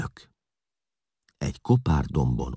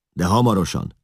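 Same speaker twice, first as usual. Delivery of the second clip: raised, impatient command; giving orders; 5 semitones higher, 4 dB louder than usual. A narrator's reading. Eszlász hurries the servants along, commanding and raised. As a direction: press them to hurry. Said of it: "rendelkezett Eszlász".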